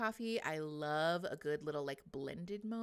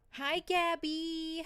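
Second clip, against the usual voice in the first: higher, slow, drawn-out hello; sing-song greeting; 11 semitones higher, 6 dB louder, much slower than usual. Real, not acted. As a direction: stretch the name out sweetly.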